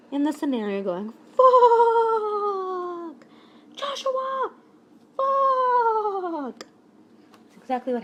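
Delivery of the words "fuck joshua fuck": a high, bleating goat cry of "Fuck"; bleating goat mom. Retold or imitated. Mom cursing at Joshua, bleating high like a goat. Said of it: "she sounded a little less like a goat".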